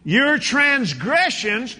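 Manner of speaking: loud, high, slow preaching; full-force pulpit proclamation. Acted. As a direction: drive each word home to the church. Preaching the verse again.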